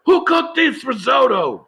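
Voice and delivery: high, slow, shouted chef rant; furious chef. Pretend. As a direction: yell it like an enraged head chef. Impersonating Gordon Ramsay yelling.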